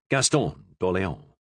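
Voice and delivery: ordinary delivery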